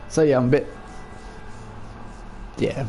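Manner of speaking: ordinary delivery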